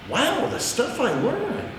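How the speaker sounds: high, slowed, marvelling wow; wide-eyed wonder at what he learned; amazed wow. Real, not acted. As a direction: let the amazement lift the voice. Marvelling at own journal insights.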